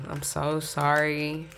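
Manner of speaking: slow drawn-out sorry; slow apology. Real, not acted. Apologising slowly to viewers about a dead connection. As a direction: stretch out the sorry.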